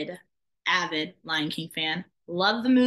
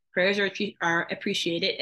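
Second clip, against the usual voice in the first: normal talking voice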